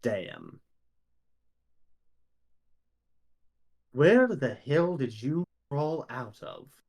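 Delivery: a slow, lightly voiced sneering stranger; mysterious voice. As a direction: drawl it out like a disdainful wizard. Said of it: "you hear a voice go".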